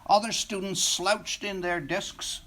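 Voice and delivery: plain reading voice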